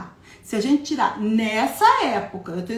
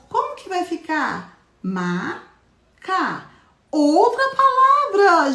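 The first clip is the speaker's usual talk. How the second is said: put-on high, playful voice for little kids; teacher playing a syllable game with kids, higher sing-song; 8 semitones higher, 4 dB louder, slower than usual. Shown as a demonstration. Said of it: "teacher game with children".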